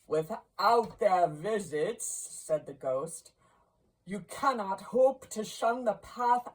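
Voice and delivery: stern ghost. A narrator's reading. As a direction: lay down the doom.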